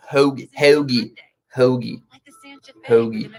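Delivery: attempted Philly accent on single words; exaggerated Philly pronunciation. Shown as a demonstration. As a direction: try out Philly vowels, word by word. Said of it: "there's, like, the Philly accent".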